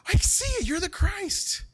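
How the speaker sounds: gasp, then very high, loud amazement; hammed-up eureka outburst; Peter/character's excited realization. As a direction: squeal the discovery in mock astonishment. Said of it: "even though Peter saw it".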